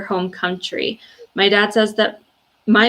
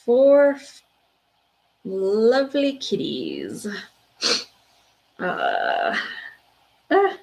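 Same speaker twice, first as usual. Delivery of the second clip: choked up; much slower than usual. Real, not acted. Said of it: "sorry, guys".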